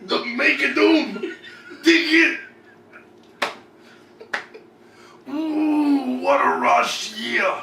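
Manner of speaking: high loud promo shout; Macho Man voice. Acted. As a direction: yell it like a wrestler. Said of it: "with the macho voice".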